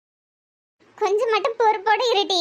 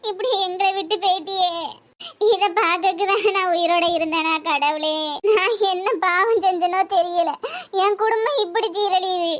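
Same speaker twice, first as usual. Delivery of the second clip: wailing lament over her daughter; crying. Acted. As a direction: grieve aloud through sobs. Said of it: "ஒரே அழுகு சத்தமா இருக்கு". (அழுது புலம்பல்)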